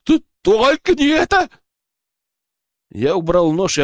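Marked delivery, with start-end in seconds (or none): 0.0-1.5 stuttered, high-pitched wail; whimpering plea. A narrator's reading. Poacher begging his interrogator, high stuttering whimper. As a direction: stammer a terrified high plea. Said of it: "заскулил браконьер".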